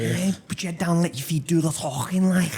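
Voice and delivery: high-pitched exaggerated Scouse; hammed-up Liverpool lad giving advice; Scouse accent. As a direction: put on a thick, high Scouse.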